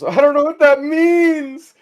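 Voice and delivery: high, loud, drawn-out mock wail; bewildered shout. Man answers the capybara's prophecy, bewildered mock shout. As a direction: cry it out slowly in comic despair.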